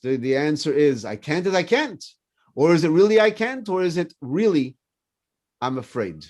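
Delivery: ordinary talk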